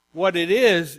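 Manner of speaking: normal talking voice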